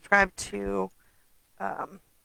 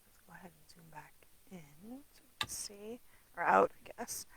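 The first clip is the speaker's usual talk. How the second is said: whispered aside; 11 dB quieter than usual. Real, not acted. Muttering while adjusting camera zoom.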